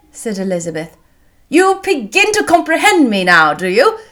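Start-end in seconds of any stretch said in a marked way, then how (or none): 1.5-4.0 lively loud question, starting high and falling; crying out. A narrator's reading. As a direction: exclaim to her in playful challenge. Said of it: "cried he, turning towards her".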